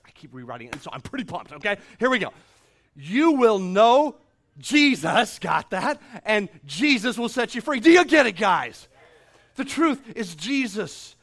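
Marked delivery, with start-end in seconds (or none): 2.0-11.1 loud, slow preaching; full-force preaching. Acted. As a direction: hammer the message home. Preaching Jesus as truth.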